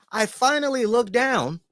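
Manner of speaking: ordinary delivery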